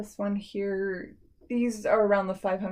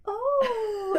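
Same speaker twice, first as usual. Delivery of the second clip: mock surprise; 14 semitones higher than usual. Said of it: "I'm like".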